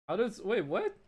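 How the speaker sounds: ordinary talk, nothing marked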